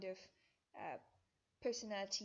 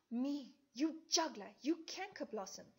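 furious Hermia; 4 semitones higher, 6 dB louder than usual. Acted. Hermia turning on Helena, loud and furious. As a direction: spit the insult at her rival.

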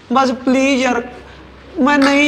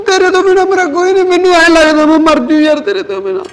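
higher, louder crying plea; breaking into sobs, desperate; tearful pleading; 3 semitones higher, 6 dB louder than usual. Acted. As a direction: beg her through tears not to leave. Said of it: "ਤੂੰ ਨਾ ਰੋ ਨਾ".

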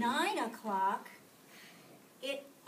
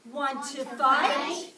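grouchy challenge; 2 semitones higher, 7 dB louder, slower than usual. A narrator's reading. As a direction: bark it out like a tiny bully. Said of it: "said the grouchy ladybug".

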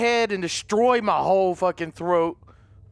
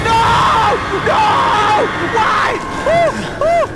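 terrified shriek; 11 semitones higher, 8 dB louder than usual. Real, not acted. Chased, screaming.

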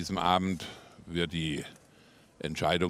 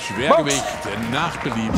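Schwergewicht nach Belieben punched high and loud; emphatic; 6 semitones higher, 6 dB louder than usual. Real, not acted. Commentator emphasis.

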